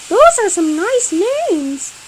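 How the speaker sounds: cat voice. Acted.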